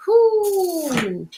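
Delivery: high, drawn-out falling whoo; long hoot of self-approval. Real, not acted. Exclaims over own point.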